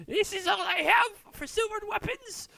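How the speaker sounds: a thrilled smith who finally has a buyer; eager dwarven blacksmith. Acted. Dwarf smith answering customers about silvered weapons, high and eager. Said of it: "And he goes".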